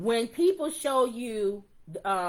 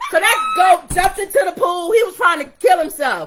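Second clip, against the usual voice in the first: loud laughing; 6 semitones higher, 8 dB louder than usual. Real, not acted. Laughing outburst about the goat.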